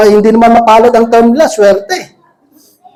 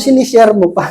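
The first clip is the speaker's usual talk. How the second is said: amused chuckle through the words; laughing; 3 semitones lower than usual. Real, not acted. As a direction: laugh as you tease them gently. Laughs while teasing the congregation.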